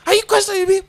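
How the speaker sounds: very high-pitched, challenging question; mock outraged mom. Mom challenging child between slaps, mock outrage. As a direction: demand it in a high, put-on parent voice.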